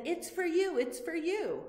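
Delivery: offering a present. Pretend. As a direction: hold out a gift and coax. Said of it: "I had a present ... and I said".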